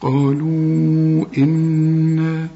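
"قالوا إن" chanted slowly, long u held on one note, then hummed n; long madd. Shown as a demonstration. Teacher demonstrating joined recitation, long madd. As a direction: stretch "قالوا" before "إن", hum the n. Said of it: "عند الوصل مد اربع حركات".

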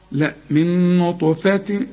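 slow recitation of min nutfatin without nasal hum; teacher models the tanween plainly before hamza; correct recitation. Shown as a demonstration. Teacher corrects student's Quran reading, slow clear recitation. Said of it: "تنوين وبعده همزة، ما فيش غنة".

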